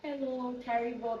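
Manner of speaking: lightly put-on caller greeting; friend on the phone.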